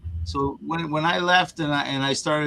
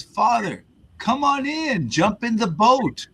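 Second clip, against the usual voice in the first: calling rowboat man; 8 semitones higher than usual. Retold or imitated. Rowboat man calls to priest.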